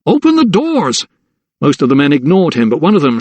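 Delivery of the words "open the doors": high, urgent yell; desperate shout. A narrator's reading. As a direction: call down with all your force. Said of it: "shouted again".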